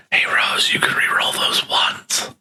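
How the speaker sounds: unvoiced whisper to a friend. Real, not acted. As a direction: slip her a secret tip. Secret tip to player.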